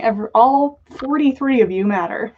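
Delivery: ordinary delivery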